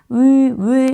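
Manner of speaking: 으 held as one pure vowel, lips and tongue fixed. Shown as a demonstration. Teacher demonstrates 으 as a single fixed vowel. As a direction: hold 으 steady without gliding. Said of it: "이렇게 발음하지 않아요".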